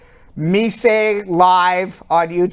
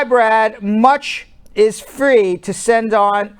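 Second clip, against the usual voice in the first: usual speaking voice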